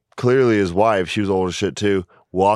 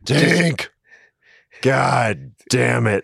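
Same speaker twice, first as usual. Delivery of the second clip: put-on grumpy old man; 2 semitones higher, much slower than usual. Imitating the old man about herself.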